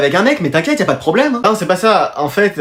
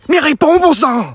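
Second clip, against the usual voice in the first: exasperated, higher-pitched demand; impatient call; 6 semitones higher, slower than usual. Acted. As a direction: demand an answer over the radio. Calling impatient into walkie-talkie.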